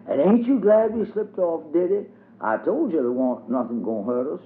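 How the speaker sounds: put-on high, happy little girl's cry; bubbling over with fun; gleeful child. A narrator's reading. Dubs delights in the mud kitchen, high gleeful child voice.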